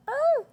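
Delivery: high, loud scream of pretend fright; mock scream. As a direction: let out a high, loud shriek. Pretend attack. (صرخة تمثيلية عالية وحادة)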